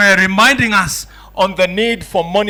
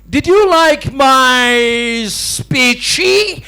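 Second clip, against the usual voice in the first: slow, higher, teasing speechy; mock pidgin comeback; 5 semitones higher, 3 dB louder, much slower than usual. Chinese professor turning the joke on the British guy, slow mock pidgin. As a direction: hand the joke back, slowly and smugly. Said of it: "he turns to the British guy and he says".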